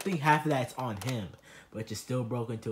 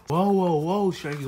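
loud, higher startled protest; startled whoa; 4 semitones higher, 7 dB louder than usual. Acted. Sebastian startled as Sharky confronts him, loud whoa.

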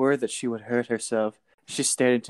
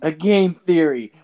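MatPat catchphrase; 5 semitones higher, 8 dB louder, slower than usual. Retold or imitated.

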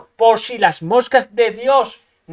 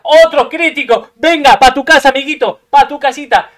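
angry shouting; 4 semitones higher, 10 dB louder than usual. Real, not acted. Raging at another critical hit.

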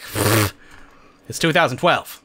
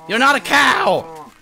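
high, drawn-out startled protest; startled yell; 10 semitones higher, slower than usual. Real, not acted. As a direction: cry out in surprised indignation. Startled by something unexpected.

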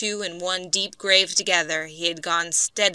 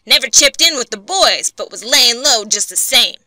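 loud, higher resentful complaint; growling grudge; 4 semitones higher, 6 dB louder than usual. A narrator's reading. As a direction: grumble it with disgust. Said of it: "growled Skid".

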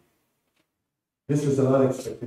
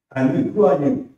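challenging; 4 semitones higher, 7 dB louder than usual. Acted. Ngozi challenging the policeman.

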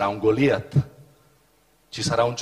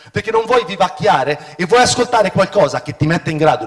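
higher and louder, urgent preaching; preaching at full force; 7 semitones higher, 9 dB louder than usual. Acted. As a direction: drive the point home to the congregation. Impassioned sermon peak. (predica appassionata, forte e alta)

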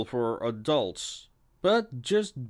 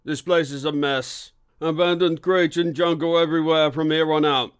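raised, louder coach driver's voice; driver announcing; 5 semitones higher, 6 dB louder than usual. A narrator's reading. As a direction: call it out to the passengers, higher and louder. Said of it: "as the driver announced".

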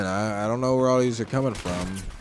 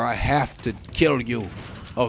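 normal talking voice

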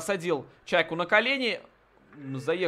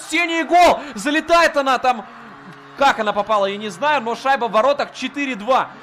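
loud, high goal shout; excited hockey goal call; 6 semitones higher, 6 dB louder than usual. Real, not acted. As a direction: roar the goal, then keep it up. Goal scored. (громкий крик «гол»)

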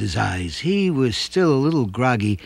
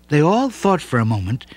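usual speaking voice

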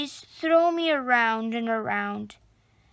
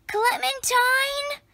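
calling out; 10 semitones higher than usual. A narrator's reading. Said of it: "I call".

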